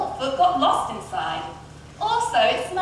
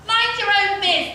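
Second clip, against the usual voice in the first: curt reply; 7 semitones higher, 3 dB louder than usual. A narrator's reading. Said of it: "the prince replied".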